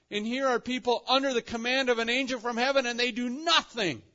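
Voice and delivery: loud, high, pressing outcry; fervent preacher at peak intensity; impassioned preaching. Real, not acted. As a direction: build at full force to the final word. Preacher's incredulous climax after excited retelling.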